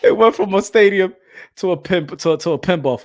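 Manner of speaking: loud, breaking up with laughter; real laughing. Real, not acted. Commentator mocking the venue downgrade, loud and cracking up. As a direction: burst it out through laughter. Laughing at his own joke.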